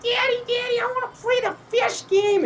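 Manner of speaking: put-on high childish begging; whiny kid. Kid begging his dad in a high childish whine. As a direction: beg like a little kid at a fair.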